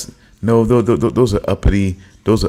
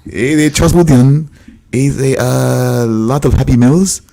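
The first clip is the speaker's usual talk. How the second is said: mock accent; 3 semitones higher, 5 dB louder than usual. Mock foreign voice teasing friend.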